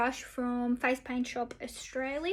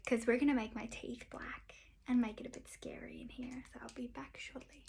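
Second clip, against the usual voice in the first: whispering; 5 dB quieter than usual. Real, not acted. Whispered makeup tutorial.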